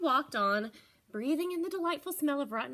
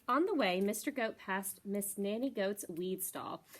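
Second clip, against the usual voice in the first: usual speaking voice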